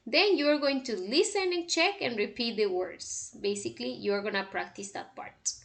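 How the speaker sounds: usual speaking voice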